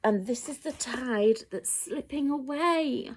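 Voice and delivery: ordinary delivery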